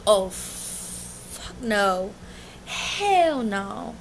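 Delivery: long drawn-out, mock-horrified no; retold freak-out refusal. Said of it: "I was starting to freak out".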